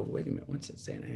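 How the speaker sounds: whispered, puzzled to himself; hushed puzzlement. Retold or imitated. Man squinting at his old trophy, whispering in puzzlement. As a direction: whisper it as you squint at the plate. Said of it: "and I go".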